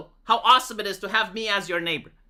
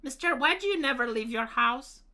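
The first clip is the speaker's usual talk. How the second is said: put-on little girl voice; 4 semitones higher, 5 dB quieter than usual. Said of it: "And it's like".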